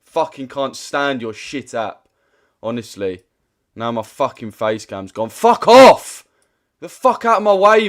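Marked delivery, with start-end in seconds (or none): 5.3-7.9 very loud, high shout; real outburst; furious yell. Real, not acted. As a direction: bellow it at the screen. Face cam gone, raging.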